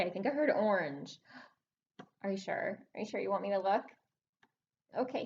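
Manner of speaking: ordinary talk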